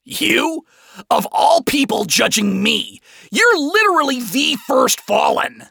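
high, outraged screech; frustrated shriek. A narrator's reading. Adam snaps back at Lucifer's judging, shrieking in frustration. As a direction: shriek it in indignant disbelief. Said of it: "shrieked in frustration, his voice wavering".

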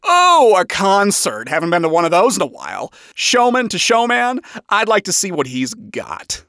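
smug, theatrical showman; showy, smug swagger; Lucifer. A narrator's reading. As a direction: open big and high, then settle into cocky ease. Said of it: "Lucifer said in a smug tone".

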